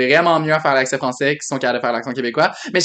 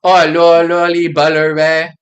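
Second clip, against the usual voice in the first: put-on Saguenay accent; 5 dB louder, slower than usual.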